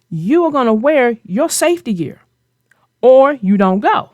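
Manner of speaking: usual speaking voice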